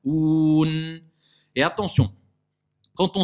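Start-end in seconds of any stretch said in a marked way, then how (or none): 0.0-1.0 louder sustained Oun, vowel kept oral; long clean Oun; clear OU. Shown as a demonstration. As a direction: hold a pure OU in Oun before closing on N. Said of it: "C'est clair?".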